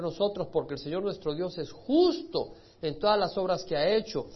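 loud, ringing prayer read from the pulpit; fervent scripture reading. Acted. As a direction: proclaim the confession with full force. Reads Daniel's prayer aloud.

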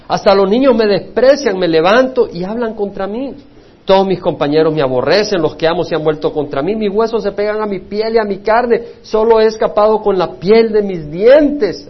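loud, high lament read out; impassioned scripture reading. Acted. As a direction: cry out Job's words to the church. Reading Job's lament with fervor.

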